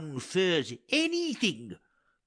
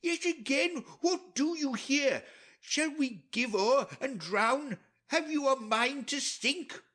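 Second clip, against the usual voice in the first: mocking taunt; 7 semitones higher than usual. Sailor taunts the drowning lords.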